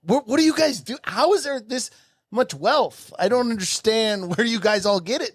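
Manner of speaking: genuinely confused by the wealth; baffled. Retold or imitated. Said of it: "I'm like".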